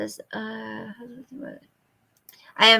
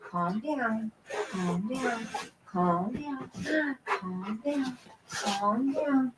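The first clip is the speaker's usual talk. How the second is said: calm down, said softly again and again; gentle quiet soothing to a pet; soft soothing hush; 10 dB quieter, slower than usual. Real, not acted. Soothing the excited dog.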